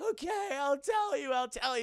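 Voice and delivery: high, loud squeaky surrender; broken victim. Acted. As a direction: give in like a cartoon coward. Victim gives in, acted.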